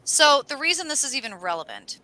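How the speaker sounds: ordinary talk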